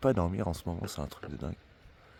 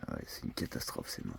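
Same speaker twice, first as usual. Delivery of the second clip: whispering; 13 dB quieter than usual. Real, not acted. Whispering at night.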